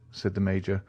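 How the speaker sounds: plain reading voice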